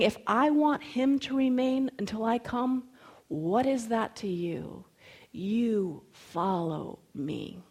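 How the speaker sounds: slow down to a grave, deliberate command; firm, intent. Acted. Jesus to Peter, looking him dead in the eyes, firm and intent. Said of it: "looking at him dead in the eyes".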